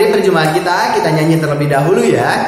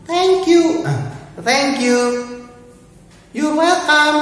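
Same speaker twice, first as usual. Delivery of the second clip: much higher thank you and welcome; high childlike voice; 12 semitones higher, slower than usual. Acted. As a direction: pitch way up like a little girl. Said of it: "niru suara ibu-ibu".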